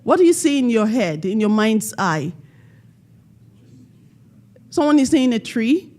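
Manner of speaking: ordinary talk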